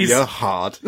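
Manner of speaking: jokey imitation of a British accent; British accent, breaking into a laugh; Ricky Gervais. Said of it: "I just did a British accent".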